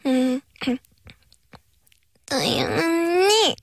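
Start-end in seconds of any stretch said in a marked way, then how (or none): 2.3-3.5 put-on high, slow cutesy baby call. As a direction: coo it like a toddler. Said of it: "애기 목소리로".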